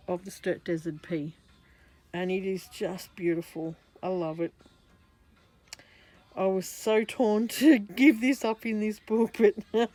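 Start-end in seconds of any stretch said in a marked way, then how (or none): 6.3-9.9 louder, words broken by laughter; laughing while talking. Real, not acted. Admitting to viewers she hated giving the artwork away, laughing. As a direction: laugh through the confession.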